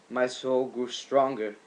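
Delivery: usual speaking voice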